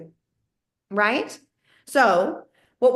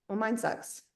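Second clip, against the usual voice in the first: much softer, dejected aside; deflated mutter; 10 dB quieter than usual. Retold or imitated. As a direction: let the air out, mumbled disappointment. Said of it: "And you're like".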